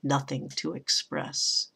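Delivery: slow gloomy poem reading, dying away; Poe-ish verse reading. Shown as a demonstration. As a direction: let each phrase hang, fading to a hushed, low close. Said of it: "Gets almost Edgar Allan Poe-ish there".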